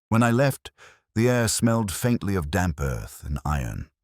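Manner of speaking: ordinary delivery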